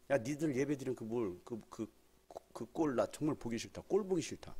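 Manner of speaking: a lightly voiced grumpy God; mock bored God. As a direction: play God complaining and flipping channels. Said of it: "하나님 그러지 않겠어요?".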